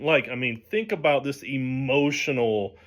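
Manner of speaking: usual speaking voice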